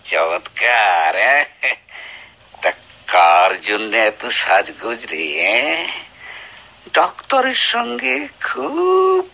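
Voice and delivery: taunting a wife he suspects; sneering taunt. Acted. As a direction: needle her with contempt. Husband taunts wife about doctor.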